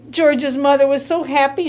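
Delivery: usual speaking voice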